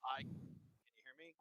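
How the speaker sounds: distant, quiet mic; far from mic. Real, not acted. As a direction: voice far from the microphone. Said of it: "it sounds like you're halfway across the room".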